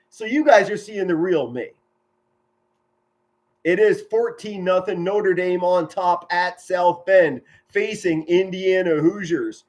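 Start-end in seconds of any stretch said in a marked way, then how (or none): none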